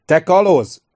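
loud, high teasing shout. A narrator's reading. The youngest Kondoin son calling to the pirate, loud teasing shout. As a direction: call it out across the dock. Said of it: "kiáltotta a legifjabb Kondoin fiú".

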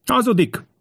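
very high, frantic outcry; high-pitched desperate accusation; desperate shout. A narrator's reading. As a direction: shriek the denial in panic. Desperate prince denies it again.

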